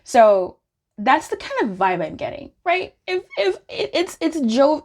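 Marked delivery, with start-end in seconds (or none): none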